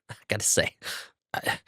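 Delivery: ordinary delivery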